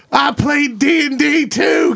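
high, slow shouted confession; eager nerd confessing. Retold or imitated. Nerd confessing to the crowd, slow high shout. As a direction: blurt it proudly to the crowd, slowed way down. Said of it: "one dude's like".

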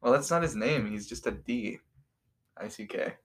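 usual speaking voice

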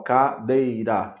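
"cadeira" slowly split as ca-dei-ra; syllable by syllable. Shown as a demonstration. Teacher showing that each syllable is one emission of sound, "cadeira" syllable by syllable. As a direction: break "cadeira" into three separate sounds. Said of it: "Cada sílaba é uma emissão de som".

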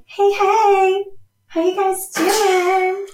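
high, slow, friendly dog greeting; cheery high pup voice drawn out slowly; Riley's dog voice. Retold or imitated. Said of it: "She'd probably be like".

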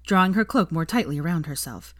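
usual speaking voice

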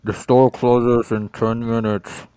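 muffled speaker announcement. Acted. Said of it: "muffled voice on the terrible speaker".

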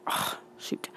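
breathy whispered dismay; a soft voiceless groan of "shoot". Retold or imitated. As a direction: whisper it under your breath in defeat. Retold dismay at locked door.

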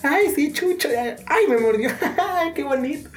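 high-pitched, laughing mock yelps; mock pained squeal. Imitating someone bitten by a dog, mock pained squeal. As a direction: act out a silly, high-voiced dog lover.

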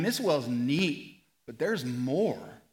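ordinary talk, nothing marked

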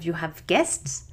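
ordinary delivery

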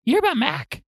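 high-pitched mimicked sister; imitating frantic sister. Sister repeating her question on FaceTime, mimicked in a high voice. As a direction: ask it way up high, like an urgent caller. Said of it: "That's how she's talking to me".

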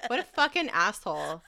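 normal talking voice

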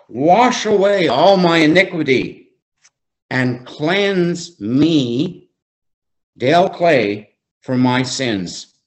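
scripture read phrase by phrase; slow, emphatic reading. Real, not acted. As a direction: start lifted, then very slow and deliberate. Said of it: "I'm gonna read it slowly".

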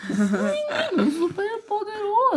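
high slow mocking villain; villain impression. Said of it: "Ele fala assim".

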